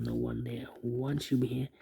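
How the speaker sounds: normal talking voice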